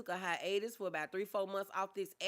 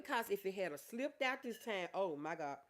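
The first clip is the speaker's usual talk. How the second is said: usual speaking voice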